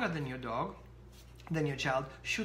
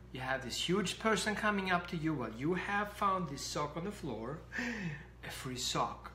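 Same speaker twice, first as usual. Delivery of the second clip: ordinary talk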